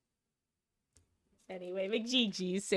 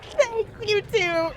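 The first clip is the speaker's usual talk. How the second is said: excited thanks; 10 semitones higher, 6 dB louder, much slower than usual. Real, not acted. Thanking a viewer on stream.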